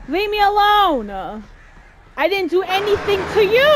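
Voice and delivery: high, loud fast protest; angry shouting. Real, not acted. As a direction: yell them away. Shouting at attacking birds.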